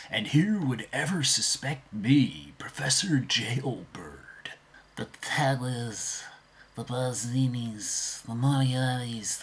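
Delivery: slow sinister villain whisper; whispered villain impression. Shown as a demonstration. Vlogger demos impressions, slow whispered villain voice. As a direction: breathe it out like a scheming crook. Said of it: "easy for me to do impressions".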